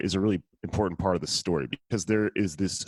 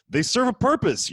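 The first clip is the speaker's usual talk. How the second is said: pitched up mockingly; sarcastic high jab; 14 semitones higher, 3 dB louder than usual. Real, not acted.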